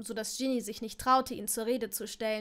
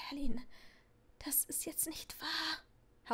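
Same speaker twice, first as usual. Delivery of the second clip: slow, unvoiced whisper, much softer; breathed whisper; 3 semitones higher, 8 dB quieter, slower than usual. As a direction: breathe it out in despair. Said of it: "hauchte Neville". (Neville haucht verzweifelt im Flüsterton)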